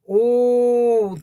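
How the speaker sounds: long, high, loud "ooh"; delighted ooh. Real, not acted. Streamer admiring a viewer's news, delighted ooh. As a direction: coo a drawn-out, admiring ooh.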